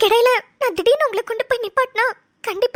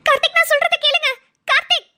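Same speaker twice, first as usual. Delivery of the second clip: high-pitched, urgent protest; urgent plea; 6 semitones higher than usual. Acted. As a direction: plead with him to stop and listen. Pleads as he pulls her along.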